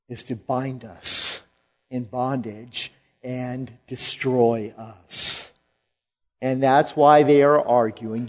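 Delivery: normal talking voice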